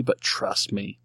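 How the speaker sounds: ordinary delivery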